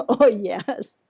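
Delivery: usual speaking voice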